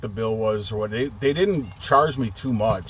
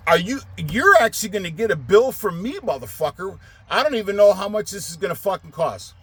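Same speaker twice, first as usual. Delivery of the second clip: higher, heated furious comeback; angry retort; 7 semitones higher than usual. Retold or imitated. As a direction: tell him he'll get the bill. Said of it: "I'm like".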